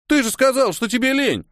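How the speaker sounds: put-on high-pitched boyish outrage; indignant. A narrator's reading. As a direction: protest indignantly, voice shooting up. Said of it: "возмутился Толя".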